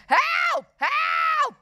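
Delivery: very high, loud repeated yells; acted panic shout; shouted cry for help. A narrator's reading. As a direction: shriek for help at full volume. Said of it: "shouted Horrid Henry".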